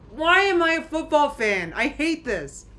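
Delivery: loud, high-pitched venting; exasperated outburst. Real, not acted. Fan fed up with football, exasperated outburst. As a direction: cry out in exasperation, then come down a little.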